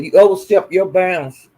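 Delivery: ordinary talk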